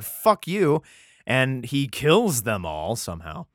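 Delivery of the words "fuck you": higher, louder mock snap; mock angry retort. The thirty Philistines insulting Samson, a hammed-up louder retort. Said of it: "be like".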